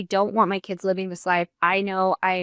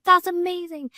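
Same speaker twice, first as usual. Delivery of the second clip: excited; 10 semitones higher than usual. Retold or imitated. Thrilled at offer.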